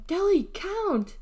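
high, drawn-out shout. A narrator's reading. R B urges his sister to count, shouting. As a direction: call it out urgently, slow and loud.

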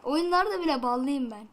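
usual speaking voice

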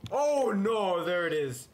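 high, loud panicked cry; scared yelp. Real, not acted. Player spotting the monster, loud and scared. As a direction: jolt of real fright.

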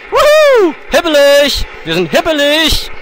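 excited. Real, not acted. Cheering after success.

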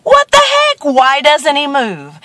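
high, loud, incredulous question; comically exasperated passer-by; acted baffled outburst. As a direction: blurt it out high, then draw out the question. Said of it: "So he said".

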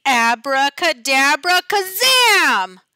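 loud, slow spell incantation; a witchy teacher casts a spell, loud and slow; magic spell. A narrator's reading. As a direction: cast the spell with a flourish.

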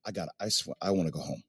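low, soft, worn-out mumble as the champ who just wants home; a lightly voiced weary player, under the breath; quiet drained mutter; mumbling tired champ. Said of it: "He's like".

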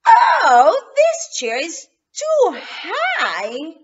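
loud, slow complaining cry; screaming. Acted. Goldilocks cries out at the tall chair, loud. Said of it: "she screams".

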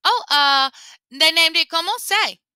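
stall, then invent a name; caught-out rabbit. Acted. Lapin invents a baby name.

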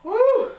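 high whooping cheer; excited woo. Real, not acted. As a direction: let out a big victory woo. Cheering after finishing the workout.

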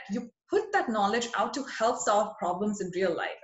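ordinary talk

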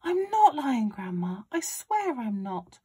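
lightly voiced boy, high and loud at first; protesting boy. A narrator's reading. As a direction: protest innocence, then ease down. Boy protesting in a shouted quarrel.

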